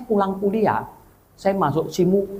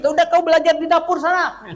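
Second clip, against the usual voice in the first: mock scolding; 12 semitones higher, 4 dB louder than usual. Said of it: "saya bilang itu".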